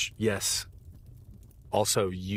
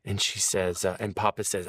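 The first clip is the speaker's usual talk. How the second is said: ordinary talk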